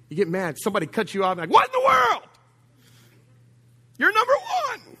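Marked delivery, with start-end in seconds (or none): 1.5-2.2 shrieked high and loud in hammed-up rage; comic outraged shriek; mock road rage. Driver cut off in traffic. 4.0-5.0 shrill, high mocking jeer; hammed-up sarcastic yell; sarcastic road rage.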